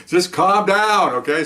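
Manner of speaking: slow, drawn-out calming voice; soothing. Retold or imitated. Officer calms the panicked arrestee, slow and soothing. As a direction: ease him down gently, slowly. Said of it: "I'm the one that's calming him down".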